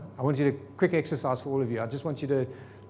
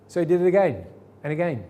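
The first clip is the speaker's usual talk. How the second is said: ordinary delivery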